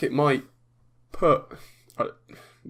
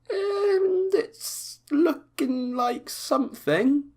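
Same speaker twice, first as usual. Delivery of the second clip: mock-emotional gush; much slower than usual. Real, not acted. Gushing over game trailer.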